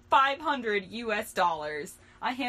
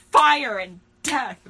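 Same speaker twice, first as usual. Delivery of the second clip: loud; 4 semitones higher, 13 dB louder, much slower than usual. Real, not acted. Rowdy party boast.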